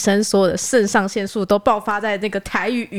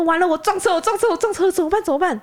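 higher, rushed mock panic; retold panic; 10 semitones higher than usual. After bumping the car ahead, a higher mock panic. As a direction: replay the panic of the fender bender. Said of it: "我就吓一跳".

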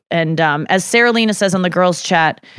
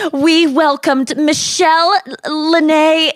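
high, slow, mock ceremonious announcement; hammed-up sweet announcement, laughing at the end; formal baby announcement; 8 semitones higher, much slower than usual. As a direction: announce it like a proud birth card. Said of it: "she wasn't like".

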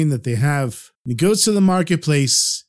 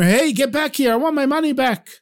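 high-pitched mild mock yell; mock shouting; 10 semitones higher than usual. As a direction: call after someone without real volume. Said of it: "He starts shouting for the farmer".

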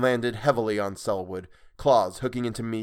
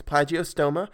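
usual speaking voice

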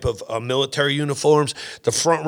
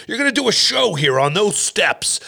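commanding general; 7 semitones higher than usual. Retold or imitated. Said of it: "He goes".

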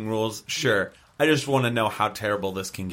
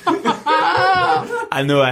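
laughing; 4 dB louder, much slower than usual. Acted. Prisoner teasing, laughing.

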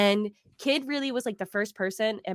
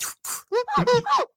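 high squealing laugh; 4 dB louder than usual. Retold or imitated. Incredulous laugh mid-quote.

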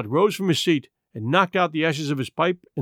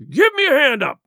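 high, loud furious cry for help up; angry cry; 10 semitones higher, 6 dB louder than usual. Acted. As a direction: bellow it out in wrath. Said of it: "he cried".

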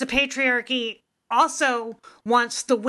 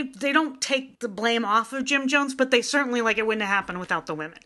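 usual speaking voice

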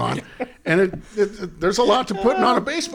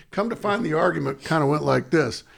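normal talking voice

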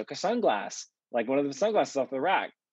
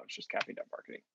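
whispered under the breath; soft throwaway repeat of the web address; quiet aside; 11 dB quieter, faster than usual. Shown as a demonstration. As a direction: say it almost to yourself. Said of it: "doesn't have a dot com".

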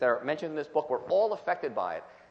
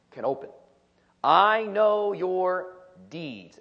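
slow, solemn reading of scripture; 6 dB louder, much slower than usual. Acted. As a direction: read each phrase deliberately, with weight. Reads Jesus' words aloud.

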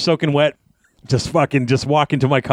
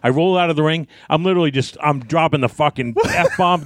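ordinary delivery

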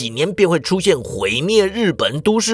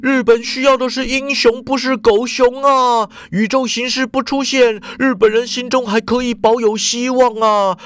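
higher desperate man's voice; raised, whining excuse; crying out while crawling; 7 semitones higher than usual. A narrator's reading. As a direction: plead his case in panic. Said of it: "边爬边叫".

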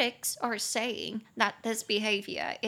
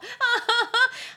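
real laugh; 13 semitones higher, 7 dB louder than usual. Real, not acted. Laughs at friend's anger.

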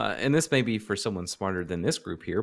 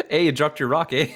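laughing, higher mock Canadian accent; 5 semitones higher, 5 dB louder than usual. Joking about a Canadian returning a rock, mock Canadian accent. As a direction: play a friendly Canadian neighbour, with an eh. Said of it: "I can't Canadian accent".

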